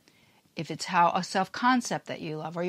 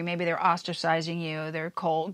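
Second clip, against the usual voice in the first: usual speaking voice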